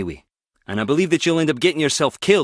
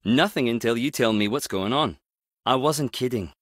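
normal talking voice

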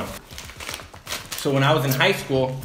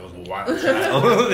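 laughing; 9 semitones higher, 5 dB louder, much slower than usual. Real, not acted. Laughs about being wild kid.

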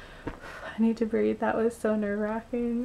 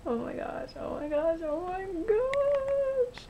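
building excitement; 7 semitones higher than usual. Real, not acted. Winner who cannot believe it, building to a squeal. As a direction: climb higher each time, drawing out the last. Overjoyed winner repeating in disbelief.

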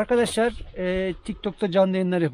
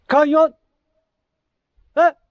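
echoing shout; 8 semitones higher, 7 dB louder than usual. Real, not acted. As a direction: shout it out to hear the canyon echo. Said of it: "Bak nasıl çınlıyor". (yankı yapan bağırış)

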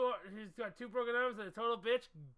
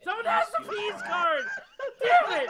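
much higher, loud laughing yell; laughing shout; 10 semitones higher, 9 dB louder than usual. Real, not acted. Frustrated outburst at card game.

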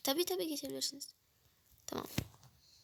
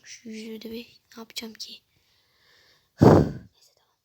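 bored whisper; 8 dB louder than usual. Real, not acted. Vlogger whispering to viewers while still waiting.

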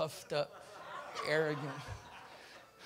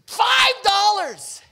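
drawn-out demand; 9 semitones higher, much slower than usual. Acted. Preacher acts out creditor's demand.

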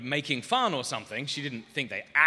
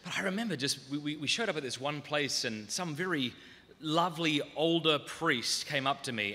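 normal talking voice

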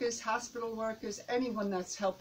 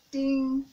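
a high, much louder "ding" rung out; loud chiming ding; loud bell ding; 5 semitones higher, 10 dB louder than usual. Shown as a demonstration. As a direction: ring the bell hard. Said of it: "by saying ding".

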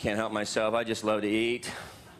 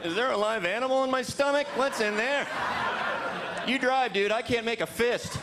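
mock queasy; 9 semitones higher than usual.

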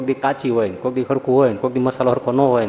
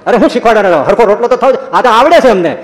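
louder, higher, offended snapping back; indignant retort; 6 semitones higher, 5 dB louder than usual. Retold or imitated. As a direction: bristle at being taught, voice raised. Jivuba's indignant retort in a quarrel. (ગુસ્સાથી ઊંચા અવાજે જવાબ)

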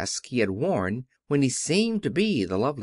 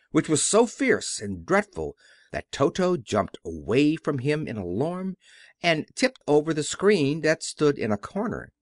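ordinary delivery

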